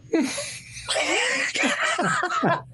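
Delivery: helpless high-pitched laughter, almost crying; high squealing laugh. Real, not acted. To his podcast cohost about Norm's ESPYs joke, high squealing laugh. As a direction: let the laugh break up high and wheezy.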